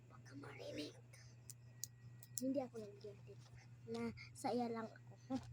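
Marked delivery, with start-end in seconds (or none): 0.1-0.9 hushed whisper so mama won't hear; whispering while hiding. Real, not acted. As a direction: whisper it, keep it secret. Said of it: "huwag kayo maingay".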